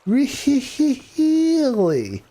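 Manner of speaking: "Really?" pitched high and stretched long; Jim Carrey. Retold or imitated. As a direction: overplay the disbelief like the comic. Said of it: "I can't do it".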